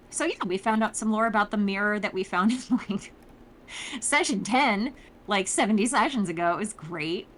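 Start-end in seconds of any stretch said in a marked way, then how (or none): none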